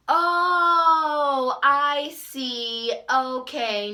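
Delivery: long, high, loud "oh"; hammed-up imitation of sudden understanding; exaggerated realization. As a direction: draw the "oh" way out, then ease down. Mimicking nail lady's sudden understanding.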